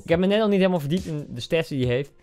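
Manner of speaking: ordinary delivery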